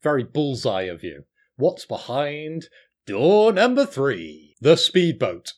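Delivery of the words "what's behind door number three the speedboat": loud, slow, booming quiz host; announcer voice building louder and higher; game show host. Acted. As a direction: ham it up like a TV game show presenter.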